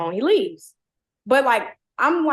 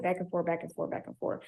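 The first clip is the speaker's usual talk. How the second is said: whispered; 5 semitones lower, 10 dB quieter than usual. Real, not acted. Trailing into a whisper.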